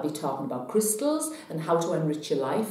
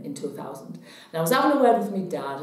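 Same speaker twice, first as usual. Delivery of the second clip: usual speaking voice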